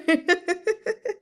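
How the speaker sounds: high snorting laugh; a short amused snort of laughter. Acted. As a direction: Xiao Chuye snorts with laughter at Fu Man. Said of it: "snorted with laughter".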